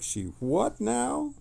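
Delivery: high, slow, drawn-out stunned question; stunned disbelief. Retold or imitated. Friend reacting to the Guardian buying ramen, stunned disbelief. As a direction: play the friend who can't believe it. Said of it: "you were like".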